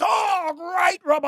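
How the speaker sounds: loud, high-pitched shout of relief, imitated; huge relief. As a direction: burst out as if hugely relieved. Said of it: "Huge relief for him".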